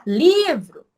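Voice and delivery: "livro" called out high, first syllable stretched and loud; loud, high stress on the LI of "livro"; called-out word. Shown as a demonstration. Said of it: "quando você grita pela palavra livro".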